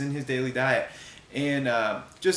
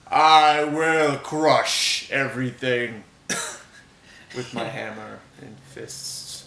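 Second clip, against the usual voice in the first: slow, booming barbarian warrior voice; Thor; 6 dB louder, much slower than usual. Acted. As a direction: boom it out like a god of thunder. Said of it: "now that [name] has the power of Thor ... booming voice".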